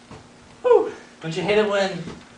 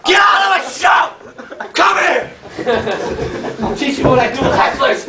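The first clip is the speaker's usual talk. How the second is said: mock angry shout; 4 semitones higher than usual. Comedian on stage to a heckler, mock furious shout. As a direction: yell at the heckler in playful fury.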